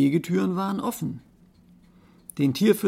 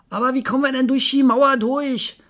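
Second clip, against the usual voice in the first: high, loud, agitated monkey; 9 semitones higher, 7 dB louder than usual. Acted. Monkey asks about getting through wall, high and loud.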